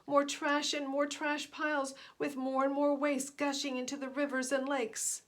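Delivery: plain reading voice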